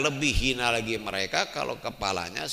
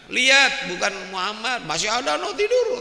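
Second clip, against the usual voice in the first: much higher, louder mocking insistence; insistent shout; 13 semitones higher, 10 dB louder than usual. Plotter shouting back at Iblis, high and loud. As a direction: yell it as if arguing back.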